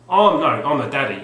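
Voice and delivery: loud hard man line; Ray Winston impression. Quoting the film line to the viewers, loud. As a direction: bark it like the prison daddy. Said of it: "Ray Winston in Scum".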